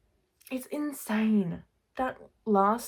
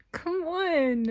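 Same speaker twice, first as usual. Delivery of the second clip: 1 exasperated; 11 semitones higher than usual. Real, not acted.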